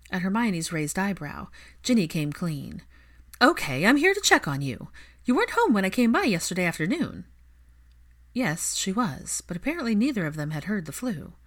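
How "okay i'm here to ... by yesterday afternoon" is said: brisk upbeat. Acted. Ginny confesses checking in.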